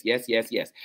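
ordinary delivery

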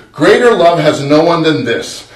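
usual speaking voice

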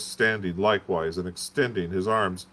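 ordinary delivery

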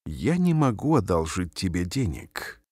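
ordinary talk, nothing marked

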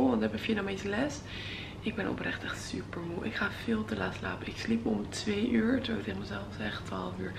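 quiet, half-whispered vlog talk; hushed morning voice. Real, not acted. Early morning vlog, roommate nearby.

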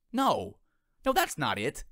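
eager protest. Acted. Eager correction, newly inspired.

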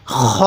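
long scraped back-of-throat start on খ; throaty খ. Shown as a demonstration. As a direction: say খ from where you gargle, a rasped "kh". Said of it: "এরকমভাবে উচ্চারণ করব".

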